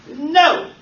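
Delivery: loud, high sharp "No!"; mock shout. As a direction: bark a flat refusal. Boss rejecting the nutritionist idea.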